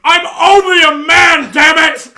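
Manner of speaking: very high, very loud drawn-out wail; full-volume mock anguished cry; hammed-up desperate shout. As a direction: belt out a cornered man's last plea. Climax of friend's desperate plea.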